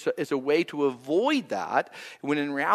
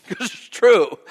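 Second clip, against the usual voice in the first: ordinary talk, nothing marked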